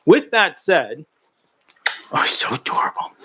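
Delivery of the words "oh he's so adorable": whisper. Real, not acted. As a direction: breathe it out unvoiced, adoring. Whispering about the dog.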